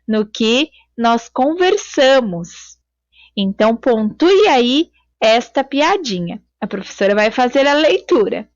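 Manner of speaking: ordinary talk, nothing marked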